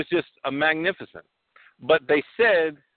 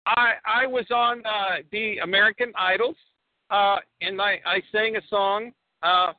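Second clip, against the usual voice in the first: put-on proud Borat, Kazakh accent; 3 semitones higher than usual. Borat tells the hosts about American Idol, Kazakh accent.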